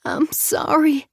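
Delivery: high, slow, tear-choked I'm sorry; Avery crying out an apology to her sister; tearful apology. A narrator's reading. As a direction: apologize through welling tears. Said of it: "tears welled and spilled down".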